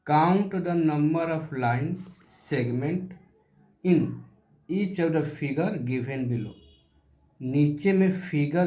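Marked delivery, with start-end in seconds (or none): 0.1-6.5 slow read-aloud. Shown as a demonstration. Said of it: "क्या लिखा है?".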